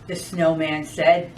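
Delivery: lively, sing-song storytime voice; animated picture-book narration; reading to children. Acted. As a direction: read it like a librarian to a room of kids.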